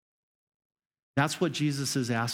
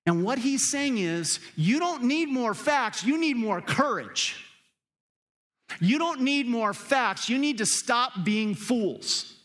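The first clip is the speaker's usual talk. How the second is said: preaching at full force; 9 semitones higher than usual. Real, not acted. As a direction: thunder it from the pulpit. Preaching climax, building.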